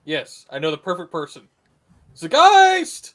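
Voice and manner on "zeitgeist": loud high call across the room. Pretend. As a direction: shout his name to summon him. Calling out to a streamer.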